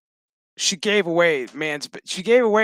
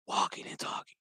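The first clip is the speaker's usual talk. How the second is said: whispered, much softer tail; hushed aside; 11 dB quieter than usual. Real, not acted. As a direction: drop to a whisper under the breath. Hushed end of exasperated remark.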